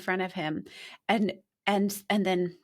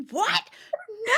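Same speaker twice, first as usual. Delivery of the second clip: mock shock. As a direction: yelp it like you can't believe it. Retelling shocked reaction, laughing.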